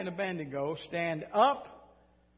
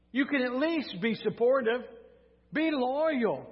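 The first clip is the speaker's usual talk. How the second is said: exasperated king, higher and louder; acted royal scolding, pitched up; furious king; 6 semitones higher, 4 dB louder than usual. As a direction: plead indignantly with disloyal underlings. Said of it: "he was furious at them".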